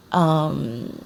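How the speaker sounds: ordinary talk, nothing marked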